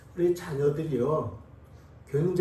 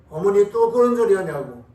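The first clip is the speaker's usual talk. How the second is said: put-on louder irritated child snapping back at mother; hammed-up annoyed retort, louder; 그런 stressed in a louder mock scolding with falling end; 3 semitones higher, 7 dB louder than usual. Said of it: "자녀들이 꾸짖는 거예요".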